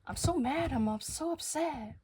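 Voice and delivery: high, loud mock-outraged wail; imitating upset coworker. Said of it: "a coworker comes up to me and goes like".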